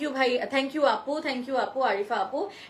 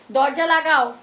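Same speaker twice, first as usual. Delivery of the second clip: loud, higher-pitched shouted order; calling out; 4 semitones higher, 10 dB louder than usual. Real, not acted. Telling someone off camera to shut the door, calling out loudly. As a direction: call sharply across the room.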